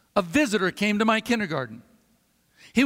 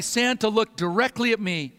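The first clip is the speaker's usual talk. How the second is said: usual speaking voice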